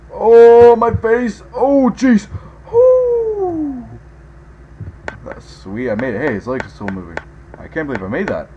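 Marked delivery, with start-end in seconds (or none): 0.1-3.9 startled yelps. Real, not acted. Crashes in game, yelps.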